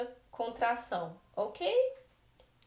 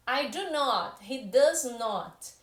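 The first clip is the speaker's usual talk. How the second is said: "not" in "He does not" stretched very slowly and said louder; teacher showing how uncontracted "does not" sounds emphatic; stressed negation; 4 dB louder, much slower than usual. Shown as a demonstration. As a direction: land hard on "not", taking your time. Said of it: "eu tô querendo dar uma ênfase pra aquela negação".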